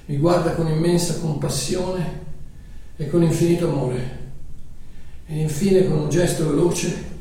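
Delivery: quiet preaching voice; hushed sermon narration. Acted.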